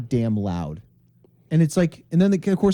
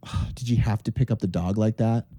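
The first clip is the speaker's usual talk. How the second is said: disapproving commenter; 6 semitones lower, 3 dB quieter than usual. Retold or imitated. Imitating disapproving online commenter Tina.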